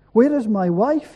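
restrained scream from a storyteller; mild narrated scream. A narrator's reading. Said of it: "he screamed".

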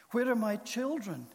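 high, loud plea; desperate pleading. A narrator's reading. Lost man begs to know about his children, pleading. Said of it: "he pleaded".

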